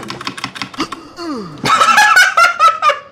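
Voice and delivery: high, breaking-out laughter; laughing fit. Real, not acted. Streamer cracking up at community voice lines, laughing hard. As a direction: burst into long, high laughter.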